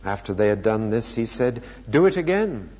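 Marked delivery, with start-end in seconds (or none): 1.9-2.7 higher, firm order; command. A narrator's reading. Elijah orders more water on the wood, commanding. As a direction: issue a brisk instruction.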